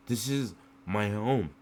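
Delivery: ordinary talk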